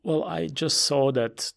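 ordinary delivery

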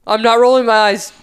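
loud, hammed-up denial; mock protesting daughter. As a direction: imitate a kid loudly denying it. Parent imitates daughter protesting.

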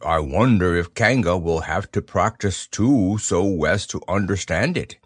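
thoughtful bear voice. A narrator's reading. Pooh thinking aloud.

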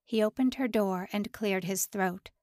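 plain reading voice

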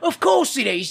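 teasing, hammed-up British voice; mock British accent. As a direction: insist in a fake British accent. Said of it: "I do this British accent".

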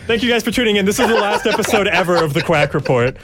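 high, giggly farewell announcement; mock sign-off. Host jokingly ends the podcast for good, in a high, laughing mock sign-off. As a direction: pretend to end the show while cracking up. Joke sign-off after the innuendo.

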